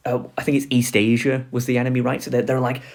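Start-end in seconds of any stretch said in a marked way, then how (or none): none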